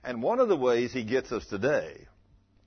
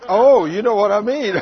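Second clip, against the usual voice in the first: much louder and higher, laughing; laughing aside; 8 semitones higher, 14 dB louder than usual. Real, not acted. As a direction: tease the crowd through laughter. Laughing with the audience.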